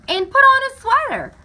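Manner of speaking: high, loud motherly call. A narrator's reading. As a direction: shout up a reminder. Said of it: "her mother would add".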